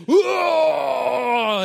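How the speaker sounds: long, high, loud hammed-up whoa; mock flexing roar. Muscle guy flexing before the crowd, mock roar. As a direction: roar out a bodybuilder's flex.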